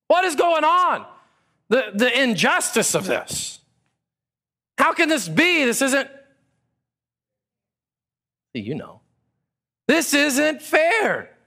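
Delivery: high, loud indignant protest, slowing on "fair"; mock outrage. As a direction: play the wronged worker's indignation. Voicing workers' outraged complaint.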